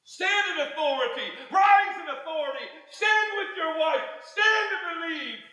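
high, urgent preacher's call; preaching at full force. Real, not acted. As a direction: command them to rise up.

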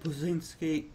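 normal talking voice

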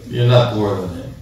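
ordinary delivery

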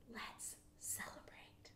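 slow, soft whisper. Real, not acted. Teacher invites the children to celebrate in a whisper.

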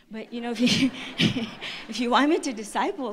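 laughing. Real, not acted. Joking about discipling crazy kids.

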